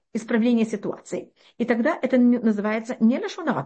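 usual speaking voice

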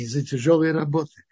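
ordinary talk, nothing marked

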